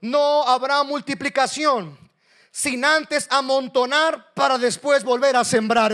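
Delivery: high, loud pulpit voice; full-force preaching. Real, not acted. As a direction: proclaim it forcefully to the congregation.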